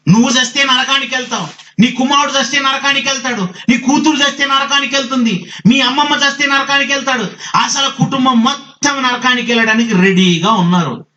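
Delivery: high, sustained warning from the pulpit; forceful preaching. Real, not acted. Preacher warns families they are bound for hell, forceful preaching.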